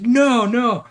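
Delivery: high, loud wail of protest; kid's upset protest. Retold or imitated. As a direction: cry no like a child refusing the news. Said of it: "He's like".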